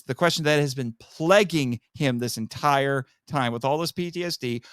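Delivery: usual speaking voice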